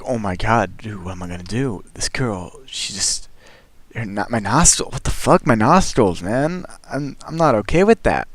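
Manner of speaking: a frantic aside under his breath; panicked whisper. Retold or imitated. Panicking to himself about her nostril comment, hushed. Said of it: "I was flipping out".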